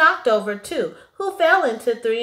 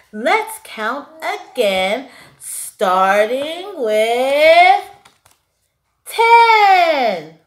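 slow stretched starting with, then ten cried out high and loud; leading the count with a triumphant cry; big build-up; 4 semitones higher, 7 dB louder, much slower than usual. A narrator's reading. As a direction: build suspense and burst on ten. Zero leads, big finish.

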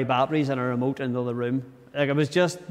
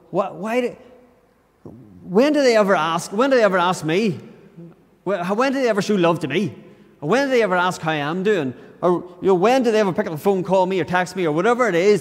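higher-pitched, exasperated complaint; frustrated role play; 5 semitones higher, 4 dB louder than usual. Acted. Preacher acting out an imagined argument in his head, frustrated. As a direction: plead your grievance, pitch lifted. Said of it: "maybe it's just frustration".

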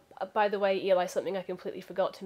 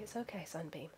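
hushed soothing; 2 semitones lower, 10 dB quieter than usual. Acted. Soothing the anxious child.

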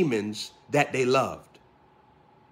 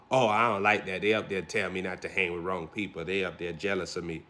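mock whiny quote; 8 semitones lower, 7 dB quieter than usual. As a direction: lower and softer mocking voice. Mocking a resentful churchgoer.

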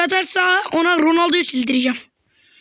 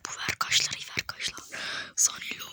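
hushed whisper; 16 dB quieter than usual. Real, not acted. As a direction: whisper it under your breath. Whispering during game.

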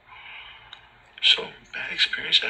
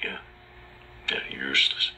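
ordinary delivery

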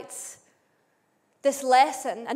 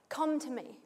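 softer, gently coaxing; tender invitation; 11 dB quieter than usual. Acted. Preacher voicing Jesus to the brokenhearted, softly tender.

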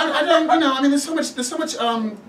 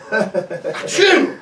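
sneezing; 4 dB louder than usual. Acted. Fake sneeze to leave work.

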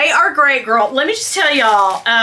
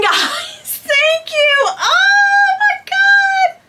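tearful joyful cry; much slower than usual. Real, not acted. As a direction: stretch it out high, overwhelmed with joy. Overwhelmed by real Hermes gift.

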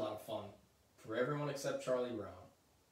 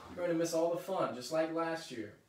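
higher, louder scolding, slowing at the end; scolding yell; 4 semitones higher, 6 dB louder than usual. Acted. Lucy scolding Linus for waiting in the patch, loud. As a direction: tell him off like a bossy big sister. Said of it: "Lucy yells at her brother".

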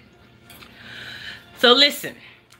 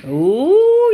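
high, loud ooh of delight; 10 semitones higher, 6 dB louder than usual. Real, not acted. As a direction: swoon over the food. Tasting the food.

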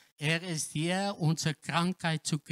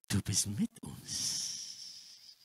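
slow, hushed, unvoiced prayer; slow whisper; 9 semitones lower, 6 dB quieter, slower than usual. Preacher repeats the prayer to God in a slow whisper. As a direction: whisper it slowly to God, assured.

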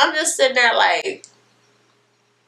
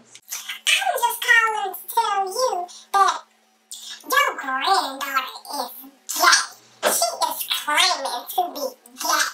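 mother tattling; 10 semitones higher, 4 dB quieter than usual. Retold or imitated. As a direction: drag it out like a smug snitch. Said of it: "she calls my grandma anyway".

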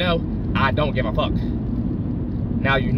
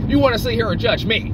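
bristling, raised voice; indignant outburst; 9 semitones higher than usual. Acted. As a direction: throw the accusation back at them. Repeats accusation, second time louder.

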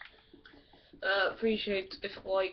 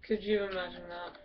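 usual speaking voice

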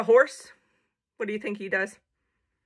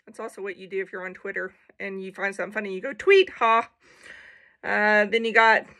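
ordinary delivery

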